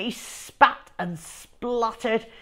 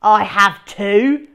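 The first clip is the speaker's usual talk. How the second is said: louder, slow insistent denial; lying; 10 dB louder than usual. A narrator's reading. Henry lying to Mum about the beans, slow insistent. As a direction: bluff it out. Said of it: "lied Henry".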